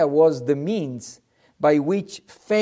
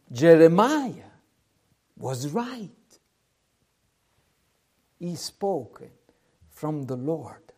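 hushed admission; 2 semitones lower, 6 dB quieter than usual. Acted. The people concede he spoke from God, hushed admission. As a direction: drop to a very soft, solemn voice.

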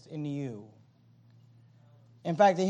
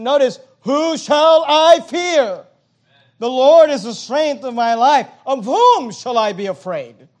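preaching at full force; 4 semitones higher, 10 dB louder than usual. Acted. Pastor declaiming Psalm 27 to the church, loud. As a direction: proclaim the psalm with all your power.

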